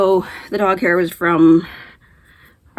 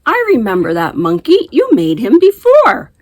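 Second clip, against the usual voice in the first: daughter's voice; 6 semitones higher, 7 dB louder than usual. Retold or imitated. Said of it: "my daughter says".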